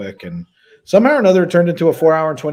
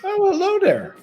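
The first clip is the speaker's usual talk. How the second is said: high sing-song greeting. Acted. Greeting the child's Mogwai.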